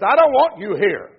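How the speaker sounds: loud, stern divine rebuke; stern rejection. Acted. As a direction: thunder the rejection down at them. Preacher voices God rejecting sinners.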